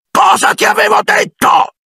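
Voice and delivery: high furious shout; thundering uncle. Acted. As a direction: roar at him across the table. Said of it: "tuonò suo zio". (zio che tuona urlando)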